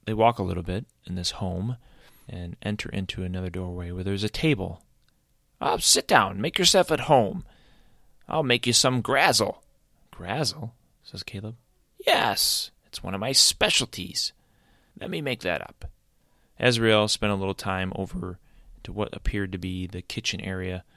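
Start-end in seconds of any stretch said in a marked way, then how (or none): 5.6-15.9 high, cheerful creature voice; friendly creature. Acted. Azrael introducing himself to Caleb, high and cheerful. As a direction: introduce yourself up high and bright.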